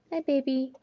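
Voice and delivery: high-pitched pet talk; cooing to a pet. Real, not acted. Greeting the dog.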